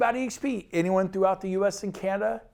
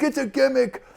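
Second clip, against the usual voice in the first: attempted New Jersey accent, pitched higher; put-on New Jersey accent, higher voice; 6 semitones higher than usual. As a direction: do your best New Jersey guy. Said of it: "trying to do my best New Jersey accent".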